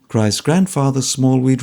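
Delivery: ordinary delivery, nothing marked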